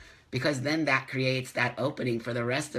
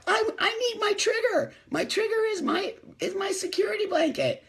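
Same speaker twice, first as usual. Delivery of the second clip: high, babyish mock whine; whiny triggered person. Mocking someone clinging to their trigger, high whiny voice. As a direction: clutch the words like a security blanket. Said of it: "you're like".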